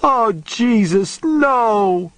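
drawn-out, high wail of horror; high, strengthless scream. A narrator's reading. Woman horrified by stacked corpses, wailing high and weak. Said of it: "she screamed in a high, strengthless voice".

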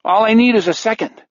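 quavering high nervous plea; shaky nervous falsetto. Acted. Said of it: "Moon says in a shaky voice".

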